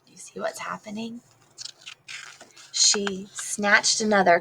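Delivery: ordinary delivery, nothing marked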